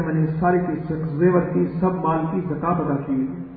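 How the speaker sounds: soft, assured telling of his faith; calm quiet explanation. Retold or imitated. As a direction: owner calmly telling the thief his secret. Owner explains his trust calmly.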